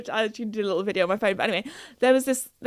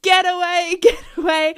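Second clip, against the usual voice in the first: a touch louder mock yell to shoo cows; imitating dad's shout; 7 semitones higher, 4 dB louder than usual. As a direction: lightly impersonate dad shooing cows. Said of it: "he was shouting at these cows".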